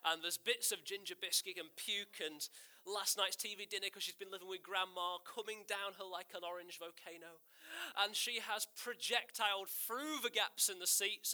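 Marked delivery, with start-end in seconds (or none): none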